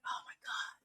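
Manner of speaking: breathless whisper, almost silent; whispered aside. Retold or imitated. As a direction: mutter it under your breath in disbelief. Mon reacts to Sam's confession.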